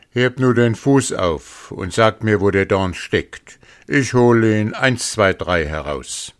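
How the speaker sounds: lightly voiced greedy wolf; eager wolf. A narrator's reading. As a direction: play the confident surgeon. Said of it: "sprach der Wolf".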